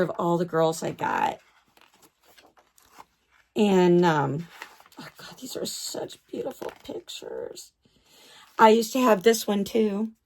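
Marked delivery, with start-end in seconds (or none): 5.0-7.7 breathless whispered awe; hushed, voiceless admiration. Real, not acted. Admiring pictures, hushed aside.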